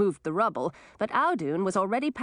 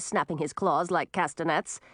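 ordinary delivery, nothing marked

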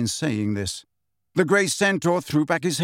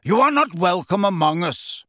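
raised, stern warning; calling out; 4 semitones higher, 3 dB louder than usual. A narrator's reading. Megorian to the giant, stern call. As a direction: call it out with authority. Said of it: "called Megorian".

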